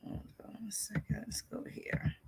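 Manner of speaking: barely audible whisper to oneself; absent, very soft self-talk; whispered aside. Real, not acted. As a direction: murmur it under your breath, without voice. Muttering to self while navigating.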